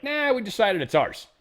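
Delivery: high, dismissive mock Chinese official; mock China voice. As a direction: play China brushing it off in a high voice.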